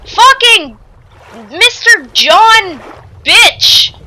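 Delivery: normal talking voice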